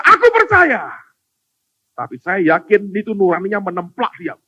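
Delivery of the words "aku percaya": high shouted cry of faith. Acted. Father crying belief to Jesus, high shout. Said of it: "dia langsung berkata".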